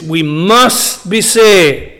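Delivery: very slow, with "must" weighted and held; emphasis on MUST. Shown as a demonstration. Preacher pointing out the verse's emphasis, stressed MUST. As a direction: spell it out slowly, leaning hard on "must". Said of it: "and notice the emphasis".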